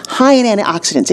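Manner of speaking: ordinary talk, nothing marked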